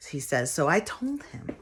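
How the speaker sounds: ordinary talk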